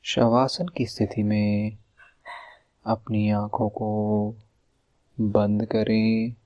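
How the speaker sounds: slow, drawn-out meditation voice with long pauses; guided meditation. Acted. Leads a relaxation meditation.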